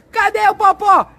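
high, drawn-out astonished exclamations; playful surprise. Real, not acted. Dad showing the girls the tow truck, high mock-amazed shouts.

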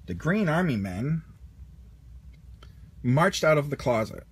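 usual speaking voice

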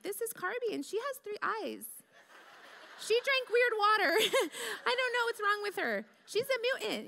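put-on high, silly little-girl voice for the doll; childish doll voice. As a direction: squeak it like a kid playing with a cheap doll. Child voicing weird Carby doll.

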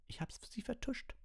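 very high, thin screech; shrieked. A narrator's reading. Hermine telling Lupin she covered up, high screech. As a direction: squeal it out without much volume. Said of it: "kreischte Hermine".

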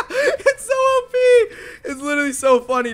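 high, slow words through laughter; laughing while talking. Real, not acted. Gamer telling viewers the trick is overpowered, laughing. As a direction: squeeze it out between laughs.

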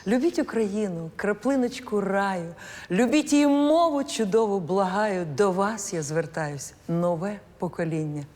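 poem recital. Acted. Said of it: "Якраз цей вірш я згадала".

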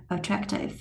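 attractive with a soft light k. Shown as a demonstration. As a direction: barely release the k in attractive. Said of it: "nhẹ xíu". (bật âm k nhẹ xíu trong attractive)

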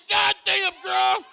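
put-on loud, startled yelp at the baby; mock shout. Grandpa yelling at the baby latched onto him, loud and startled. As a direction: yell out in shock, then slow it down. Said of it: "I said".

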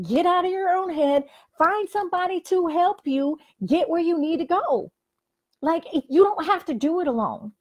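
rally them to get up and go; fired-up pep talk. Real, not acted. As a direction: coach firing up the viewer to get moving. Fired-up motivational push.

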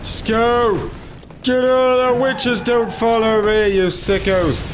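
high, urgent shouting; high-pitched frantic outburst; panicked yell. Real, not acted. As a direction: yell at pursuers to back off. Yelling at game enemies.

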